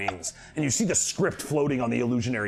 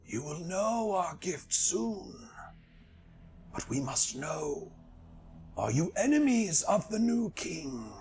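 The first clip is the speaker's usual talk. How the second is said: slow, eerie, higher voice; ancient bird spirit; 6 semitones higher, 4 dB quieter, slower than usual. Acted. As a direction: speak like an old mystic bird.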